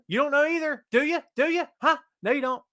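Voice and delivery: teasing challenge. Real, not acted. Teasing chat, pitch climbing.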